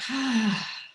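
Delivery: long breathy sigh out; audible sigh. Shown as a demonstration. Facilitator demonstrating the out-breath sigh for the group. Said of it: "sigh it out".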